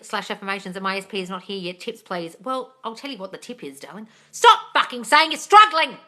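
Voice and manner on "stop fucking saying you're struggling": louder, slow, furious telling-off; exasperated yell. Real, not acted. Coach yelling at a struggling viewer, exasperated shout. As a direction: bark the order at the viewer.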